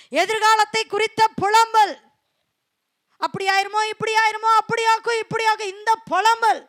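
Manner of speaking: loud, high preaching; preaching at full force. Acted. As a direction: drive the point home from the pulpit. Preacher building on lamentation. (உரத்த குரலில் பிரசங்கம்)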